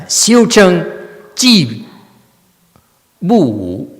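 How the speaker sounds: high and loud, each word set apart like scripture; mock recitation. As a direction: parrot the sutra with exaggerated solemnity. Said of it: "The sutra says so and so".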